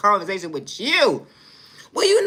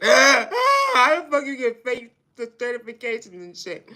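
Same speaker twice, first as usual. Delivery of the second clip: ordinary talk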